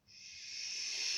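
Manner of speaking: swelling breath of wind; long, soft rustling shh; wind in leaves. Acted. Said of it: "the leaves blowing in the wind".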